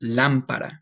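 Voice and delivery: "Lámpara" with emphasis on the first A; stress on first A. Shown as a demonstration. Teacher shows students the accent in lamp, stressing "Lámpara" on first A. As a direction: stress the opening syllable of "Lámpara". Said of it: "You're gonna put emphasis on this A".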